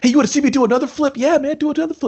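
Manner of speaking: high, rapid-fire eager chatter; mock excited show-off. Friends mocking endless flip tricks, mock excited.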